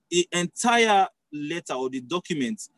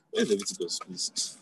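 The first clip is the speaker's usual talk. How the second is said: joking whisper; 5 semitones lower, 8 dB quieter than usual. Pretend. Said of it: "I'm just joking".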